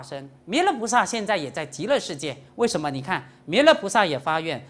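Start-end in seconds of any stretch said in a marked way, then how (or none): none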